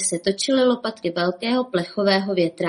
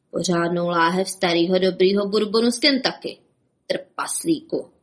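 ordinary delivery

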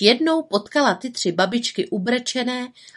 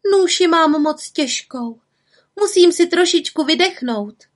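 put-on feeble old woman act; 9 semitones higher, 4 dB louder than usual. Witch pretending to be a weary granny to the forester. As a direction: play the tired old woman.

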